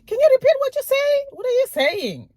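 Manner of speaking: loud, very high, rude demand; with attitude. Shown as a demonstration. Negative listener to someone with an accent, with attitude. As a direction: fire it off high, loud and quick. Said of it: "with an attitude".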